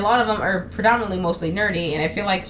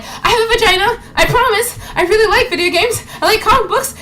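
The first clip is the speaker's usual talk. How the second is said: high, loud, over-eager protest; hammed-up desperate insisting; mock pleading gamer girl; 13 semitones higher, 5 dB louder than usual. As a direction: plead to be believed, pitched way up. Mocking plea to prove nerdiness.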